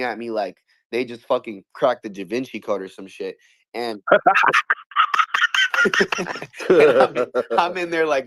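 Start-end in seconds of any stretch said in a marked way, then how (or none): 4.1-6.7 a big burst of high laughter; loud high laugh. Real, not acted. Laughing hard while telling friends the story.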